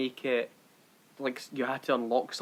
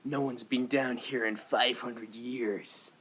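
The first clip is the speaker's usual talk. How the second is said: low, slow, hushed movie line; imitating Drake; 4 semitones lower, 5 dB quieter, much slower than usual. Host quoting a film hero in the catacombs, low and slow. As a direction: quote the explorer softly and slowly. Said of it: "And Drake goes".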